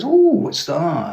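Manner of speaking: high, drawn-out curious "oh"; keen interest. Retold or imitated. Dad discovering his sons' tape recorder, really interested. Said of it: "he was really interested".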